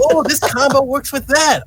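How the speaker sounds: high, eager nerdy gushing; mock excited discovery. Former card nerd reliving studying decks, excited. Acting out past nerdy enthusiasm.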